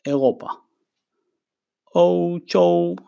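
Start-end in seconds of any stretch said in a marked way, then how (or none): 2.0-3.0 או צ'ו drawn out slowly, higher and careful; slow Chinese demo. Shown as a demonstration. Teacher modeling Chinese for the students, slow. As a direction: stretch או צ'ו into careful tones. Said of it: "מילה הבאה".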